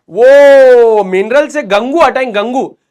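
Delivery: loud, drawn-out opening in a student's mispronouncing voice; imitated student. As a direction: mimic the student earnestly saying Gangu, first word stretched. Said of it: "वो इसको क्या बोला पता है".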